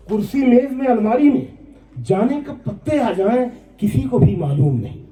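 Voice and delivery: high, ringing mushaira repeat of the verse; poem recitation. Acted. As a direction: lift the verse high, settling on the last phrase. (ऊँचे सुर में नज़्म पाठ)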